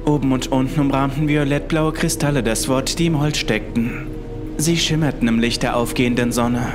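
usual speaking voice

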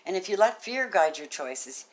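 soft measured reading of the message; oracle card reading. Acted. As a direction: read it gently like a spiritual message.

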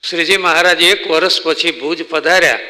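ordinary talk

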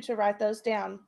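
usual speaking voice

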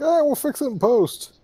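high-pitched impression of careless developers; mock dismissal. Joking about makers leaving an abrupt cutscene, mock dismissal. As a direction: wave it off in a high put-on voice. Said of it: "It's like".

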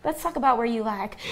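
usual speaking voice

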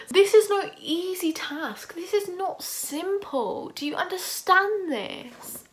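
high, slow, over-explaining voice; exasperated lecturing. Retold or imitated. Student lecturing the proctors, high, slow and exasperated. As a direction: spell it out slowly like they're clueless. Retold protest to exam proctors.

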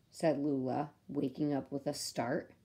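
usual speaking voice

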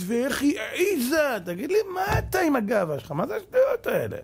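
high, indignant protest; mock personal offense. Rabbi mimicking husbands who take disagreement personally, indignant. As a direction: act affronted, pitched well up. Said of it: "יש כאלה לוקחים את זה אישית".

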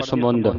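ਸਮੁੰਦ with a short nasal "u"; congregation's misreading. Shown as a demonstration. Teacher quoting congregations' reading, ਸਮੁੰਦ with short "u". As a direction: say ਸਮੁੰਦ as "samund". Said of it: "ਸੰਗਤਾਂ ਪੜ੍ਹਦੀਆਂ".

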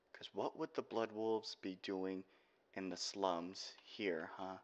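slow, soft, drawn-out taunt. Acted. Mocking the Blood Wolves' presence, slow and soft. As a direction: let each word hang.

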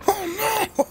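rising terrified yelp; panicked shout. Real, not acted. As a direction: shriek as the enemy won't drop. Enemy won't die.

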